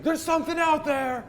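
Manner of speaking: very high, loud alarmed yell; guard shrieking a warning=174; panicked shout. Acted. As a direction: cry out in fear at the dark. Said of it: "This guy's like".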